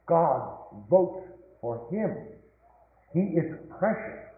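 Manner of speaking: usual speaking voice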